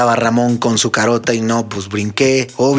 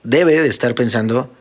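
ordinary talk

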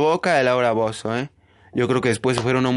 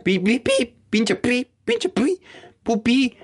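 imitated censor beeps; 8 semitones higher, much slower than usual. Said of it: "ni siquiera la pipeaban".

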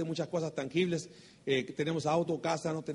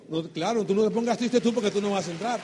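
louder retort leaning on "tú"; réplica enfática; 3 semitones higher, 5 dB louder than usual. Retold or imitated. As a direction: turn it back on them, firmly. Turns the refusal back on prospect. (réplica enfática y fuerte, recalcando "tú")

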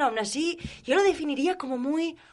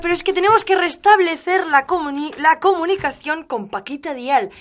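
urgent announcer; 4 semitones higher, 7 dB louder than usual. Acted. Host interrupts, urgent announcement.

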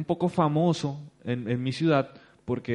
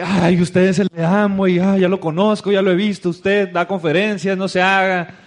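loud and eager, a stranger recognizing a celebrity; exaggerated excitement; 5 semitones higher, 9 dB louder than usual. Tipo reconoce al papá emocionado. (el tipo, emocionado y a gritos, reconoce al papá)

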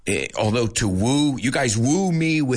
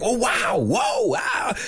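high, excited oh wow whoa; mock American amazement; 12 semitones higher, slower than usual. Host imitating Americans gasping at things, high and excited. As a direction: gush like a wowed American. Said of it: "they'll be like".

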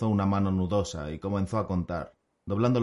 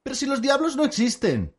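much higher and louder scoff; scoffing bard; 5 dB louder than usual. Acted. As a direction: dismiss the devil as nonsense. (bardo burlón, mucho más agudo y fuerte)

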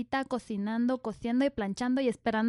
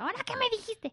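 high, soft mock-offended voice; imagined offended ex-girlfriend; 9 semitones higher, 6 dB quieter, faster than usual. Teasing co-host voices his ex, high mock indignation. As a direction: play the ex demanding an explanation, pitched up. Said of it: "tu exnovia que diga".

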